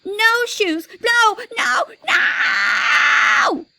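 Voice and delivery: put-on high, loud toddler scream of refusal; screaming tantrum. A narrator's reading. Little brother screams at the shoe salesman, a high, loud toddler tantrum. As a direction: scream it like a child kicking on the floor. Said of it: "He screamed".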